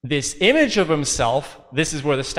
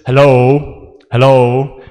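louder, calling through a closed door; Jesus calling out; 3 semitones lower, 10 dB louder, much slower than usual. Retold or imitated. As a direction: call out to someone who won't answer. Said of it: "and says".

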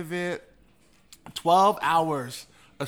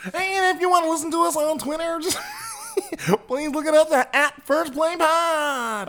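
elf voice; 9 semitones higher than usual. Acted. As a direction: giggle through a cheery plug.

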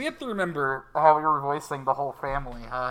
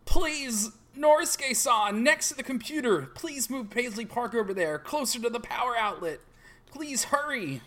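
put-on urgent higher girl voice; high pleading girl; 9 semitones higher than usual. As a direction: beg him to hurry.

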